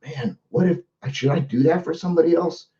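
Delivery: inner musing. Retold or imitated. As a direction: muse quietly to yourself, half-hearted. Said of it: "somebody sitting around thinking".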